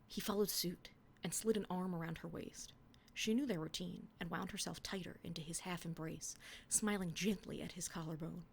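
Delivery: earnest romance reading; dramatic reading of teenage prose; story reading. Acted. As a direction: read the fanfic with mock tenderness. Reading her old fanfic aloud.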